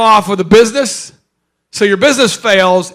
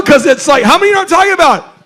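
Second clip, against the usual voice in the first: normal talking voice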